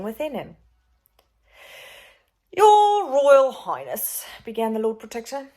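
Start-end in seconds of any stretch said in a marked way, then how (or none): 2.5-4.3 oily villain. Acted. Villain addresses the prince.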